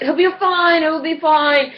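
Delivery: high, reassuring Australian voice; Australian accent. Retold or imitated. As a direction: soothe someone in an imitated Australian accent. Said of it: "in the awesome Australian accent".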